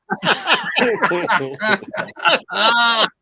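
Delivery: loud, high burst of laughter; big laugh. Real, not acted. After telling off the heckler, a big laugh. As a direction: laugh hard at your own punchline.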